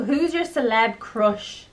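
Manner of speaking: usual speaking voice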